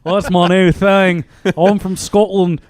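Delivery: hammed-up, shaky-grasp Australian accent; bad Australian accent. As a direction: fake an Australian accent, badly. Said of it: "I'm just gonna do a bad Australian accent".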